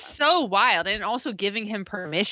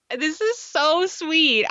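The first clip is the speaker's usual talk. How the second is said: mock swooning; 7 semitones higher, slower than usual. Mocking swoon at the show.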